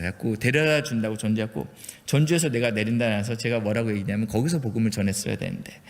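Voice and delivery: ordinary talk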